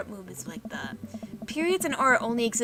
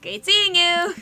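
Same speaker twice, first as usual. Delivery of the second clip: put-on chirpy teen exit; 8 semitones higher, 9 dB louder, much slower than usual. Teen escapes awkward conversation.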